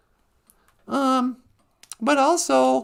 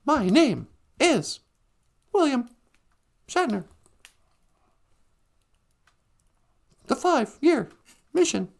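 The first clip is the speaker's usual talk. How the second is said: mock Shatner delivery. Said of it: "that's how he acts".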